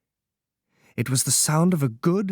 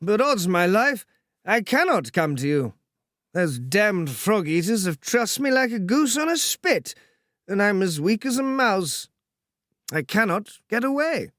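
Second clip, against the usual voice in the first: sleepy drawly voice; 7 semitones higher than usual. Acted. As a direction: complain lazily, weak but amused. Percy's voice held.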